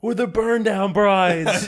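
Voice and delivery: high, loud, slowly stretched team chant; hollered, drawn-out group cheer; retold battle cry. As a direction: proclaim it like a rallying slogan.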